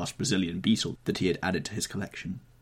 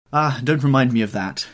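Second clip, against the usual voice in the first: loud, raised-pitch protest; crying out in protest; 6 semitones higher, 10 dB louder than usual. A narrator's reading. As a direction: cry out against the reminder. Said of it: "cried Dorian Gray".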